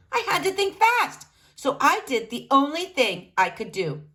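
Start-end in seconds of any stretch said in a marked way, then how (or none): none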